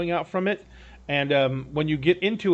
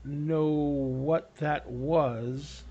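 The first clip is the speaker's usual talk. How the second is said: slow, stunned halting delivery; 5 dB quieter, much slower than usual. Acted. Lord stops as the skiff sails off, halting. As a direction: break it up with long pauses, slow and stopped. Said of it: "he kinda stops".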